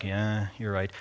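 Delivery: low, soft mock defeat; acted deflated mumble; resigned agreement. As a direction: give in meekly, dropping the voice. Acted meek agreement with Satan.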